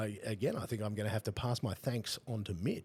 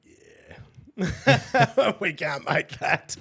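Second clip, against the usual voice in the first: high, loud, laughing "we can't make that"; the designer laughing off his design; laughing refusal; 13 semitones higher, 14 dB louder, much slower than usual. Retold or imitated. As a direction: laugh through the knock-back.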